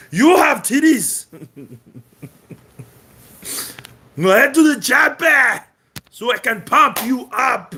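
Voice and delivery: Arnold Schwarzenegger. Retold or imitated. Austrian bodybuilder shouting at a boxer to get to the chopper. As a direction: yell it like an action hero. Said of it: "Great Arnold Schwarzenegger".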